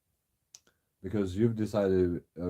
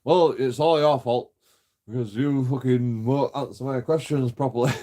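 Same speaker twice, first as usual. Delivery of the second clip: louder, higher defensive snap; defensive retort; 4 semitones higher, 6 dB louder than usual. Retold or imitated. Officer blaming him defensively, loud and higher. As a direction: imitate her blaming him, all defensive. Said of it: "she gets all defensive".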